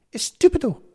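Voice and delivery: high, loud nasal scold. Acted. Missus Galdini scolding Gowser while holding her nose. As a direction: snap it through a pinched nose. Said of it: "she scolded nasally".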